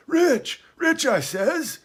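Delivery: very high, loud, indignant repeat; heated insistence. A narrator's reading. Castaway repeating his claim to a doubting boy, heated. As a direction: insist hotly, voice shooting up. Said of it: "he repeated the statement hotly".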